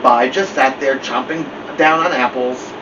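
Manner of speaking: plain reading voice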